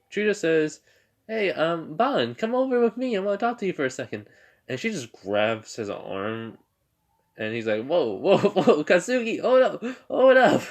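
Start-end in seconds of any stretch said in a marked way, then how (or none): 1.3-4.3 high-pitched mock girl voice; urgent summons. Girl summons boy aside in story. 7.8-10.6 high, loud mock alarm with a laugh; startled protest. Boy protests at being grabbed.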